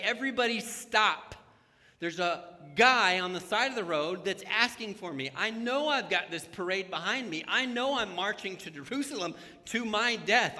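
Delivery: loud, high call to stop everyone; loud commanding call. Retold or imitated. Jesus stopping the parade for one man, loud and firm. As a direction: halt the whole crowd out loud.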